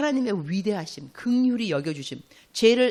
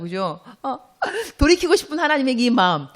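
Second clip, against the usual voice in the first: ordinary talk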